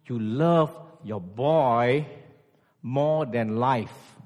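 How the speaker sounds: drawn out slowly, each word weighed; very slow emphasis. Acted. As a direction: stretch it out slowly to drive it home. Preacher stresses love slowly.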